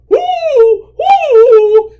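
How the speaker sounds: loud, high hooting; angry owl. Acted. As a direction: hoot crossly at full volume. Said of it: "said the owl angrily".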